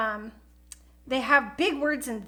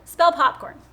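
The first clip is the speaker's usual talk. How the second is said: talking to Siri; 2 semitones higher, 7 dB louder than usual. Shown as a demonstration. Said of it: "I say, Spell whatever the word is".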